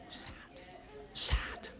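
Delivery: a hushed, breathless whisper of "Sad."; soft unvoiced aside. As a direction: whisper it like a confession.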